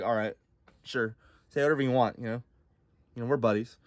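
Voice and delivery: soft, low casual agreement; easygoing God. Acted. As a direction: shrug it off quietly. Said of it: "God's like".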